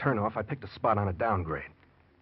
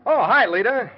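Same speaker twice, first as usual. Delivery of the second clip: loud, high greeting called across the beach; calling back; 13 semitones higher, 11 dB louder than usual. Acted. Fred answers Lita from down the beach, calling back loud. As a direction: call back to her over the distance.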